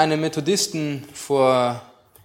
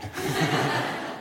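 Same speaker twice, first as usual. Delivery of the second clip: warm amused chuckle; laughing; 3 semitones lower, 7 dB quieter than usual. Real, not acted. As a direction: laugh softly at the punchline. Laughs at punchline.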